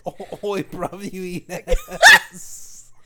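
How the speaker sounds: loud, slow, high laughing Australian; hammed-up Aussie; mock Aussie accent. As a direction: drag it out like a rowdy Aussie. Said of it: "Aussie bit continues".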